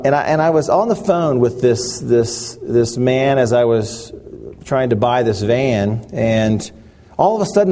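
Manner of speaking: ordinary delivery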